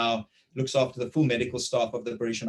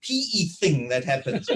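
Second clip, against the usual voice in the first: higher-pitched imitation of a puzzled wife; teasing wife; 8 semitones higher than usual. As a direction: mimic your wife poking fun, pitched up. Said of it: "she's like".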